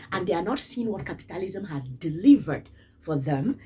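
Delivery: normal talking voice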